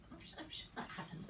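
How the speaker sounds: muttered under the breath, sceptical; whispered doubt. Real, not acted. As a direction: whisper it as a private aside. Doubting the hospital's answer.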